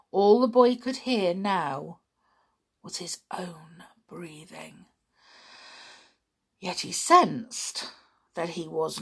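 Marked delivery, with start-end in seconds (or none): 2.8-4.9 almost silent unvoiced words; hushed whisper. A narrator's reading. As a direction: whisper into the dark. Silence in the dark.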